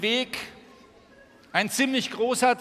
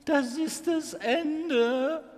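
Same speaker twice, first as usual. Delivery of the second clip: slow, high, dread-filled; frightened; 5 semitones higher, much slower than usual. Acted. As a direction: gasp it out in dread. Frightened character line.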